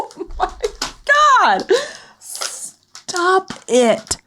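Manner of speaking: high squealing through laughter; laughing high disbelief. Real, not acted. Laughing in disgust.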